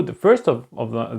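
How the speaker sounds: usual speaking voice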